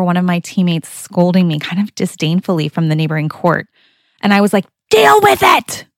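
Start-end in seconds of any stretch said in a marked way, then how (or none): 4.9-5.8 shrill, much higher and louder shriek; crazy-lady yell. Retold or imitated. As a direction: snap back at her like you've lost it. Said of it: "I yelled at her like a crazy lady".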